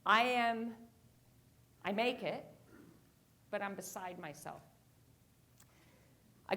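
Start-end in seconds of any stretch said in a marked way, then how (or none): none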